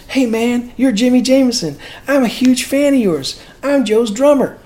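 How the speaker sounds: high-pitched imitation of an eager fan; gushing fan. Stranger accosting singer in a bar, imitated gushing fan.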